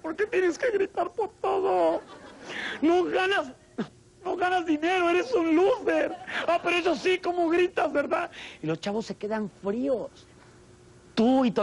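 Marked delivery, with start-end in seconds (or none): 0.0-8.3 higher scolding mother's voice; mother yelling back at her husband at dinner, scolding; shrill mom. Acted. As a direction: berate the husband as a nagging mom. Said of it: "Y la mamá dice".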